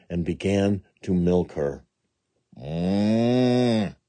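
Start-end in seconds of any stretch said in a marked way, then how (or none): 2.6-3.9 a hummed cow's moo. A narrator's reading. The spotted cow hums while Jack milks her. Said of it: "said the spotted cow".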